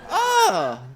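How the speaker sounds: loud, high laughing ah; real loud high laugh; burst of laughter. Real, not acted. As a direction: crack up out loud.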